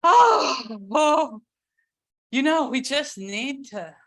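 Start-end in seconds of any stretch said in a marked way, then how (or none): 0.0-1.4 high, loud grieving wail; demonstrated cry of grief, pitched high and loud; wailing lament. Shown as a demonstration. Showing the group that lament has a sound, a loud high wail. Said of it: "lament has a sound".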